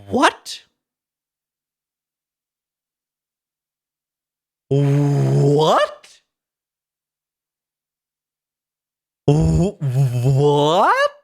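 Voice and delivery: baffled rising what, put-on voice; stunned repeated what. Real, not acted. Streamer reading a shocking patch note, repeated stunned whats. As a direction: disbelief growing with each what.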